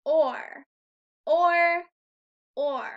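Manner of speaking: or said loud and long. Shown as a demonstration. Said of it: "Say it three times".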